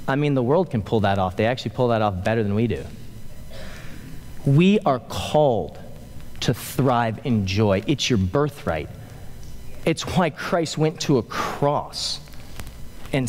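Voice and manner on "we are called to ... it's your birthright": usual speaking voice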